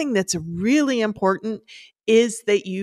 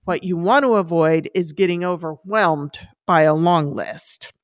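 normal talking voice

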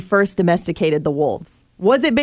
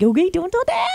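put-on baby talk; 9 semitones higher than usual. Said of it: "when I baby talk Coda".

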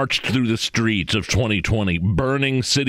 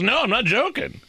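mock Biden quote; 13 semitones higher than usual. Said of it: "He goes".

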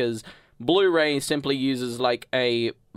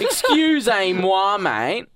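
drawn-out Aussie-accented French, pitched up; French in an Australian accent; 7 semitones higher, 6 dB louder, slower than usual. Shown as a demonstration. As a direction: exaggerate the Australian vowels on French words. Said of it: "spoke French in an Australian accent".

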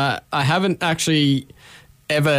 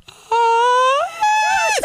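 very high sung note. Shown as a demonstration. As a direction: squeak out a top note. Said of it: "if I can't go like".

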